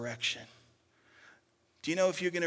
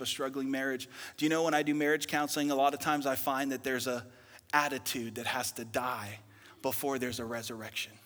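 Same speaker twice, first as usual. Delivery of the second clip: ordinary talk, nothing marked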